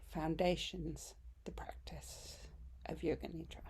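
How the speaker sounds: unhurried meditation voice with long pauses; guided meditation. Acted. Yoga teacher welcoming listeners home to yoga nidra, guided meditation. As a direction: lead them gently into rest, phrase by phrase.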